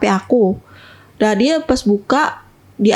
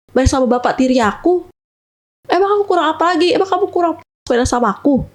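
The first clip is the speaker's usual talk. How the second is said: raised, pitched-up accusing rant; retold jealous outburst, voice pitched up; angry boyfriend; 6 semitones higher than usual. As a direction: fire the questions at her like a jealous boyfriend. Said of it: "Dia marah-marah gitu".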